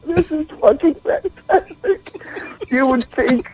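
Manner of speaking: high pitched, giggling through the words; squeaky with laughter; real laughing fit; laughing hard. Real, not acted. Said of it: "here in tears with so much fucking laughter".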